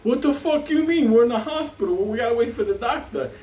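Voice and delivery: high-pitched mock panic; frantic Beanie. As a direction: squeal it out like a scared friend. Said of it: "Beanie was like".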